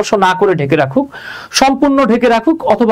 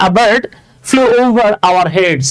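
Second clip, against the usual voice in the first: slow example read; much slower than usual. Shown as a demonstration. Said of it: "reads the example sentence".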